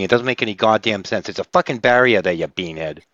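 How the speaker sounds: hammed-up Boston accent, ranting. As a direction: drop the r's like an indignant Bostonian. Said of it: "I can barely do that accent anymore".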